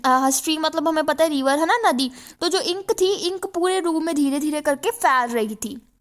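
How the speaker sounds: ordinary talk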